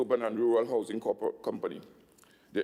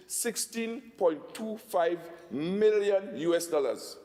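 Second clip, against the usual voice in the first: slow, deliberate figure, pitched higher; said slowly; 5 semitones higher, much slower than usual. Real, not acted. Minister telling the assembly the loan sum, slow and deliberate. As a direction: spell the sum out word by word, slowly. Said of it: "I want to say it slowly".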